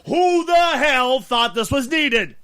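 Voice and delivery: slow, high, loud outraged question; exasperated rant. Real, not acted. Host ranting at a news site, outraged. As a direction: demand it in disbelief. Ranting about parenting article.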